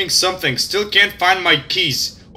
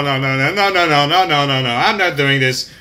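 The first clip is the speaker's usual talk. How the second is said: scared refusal; 4 semitones lower than usual. Real, not acted. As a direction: back away in fear. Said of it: "I'm a pussy".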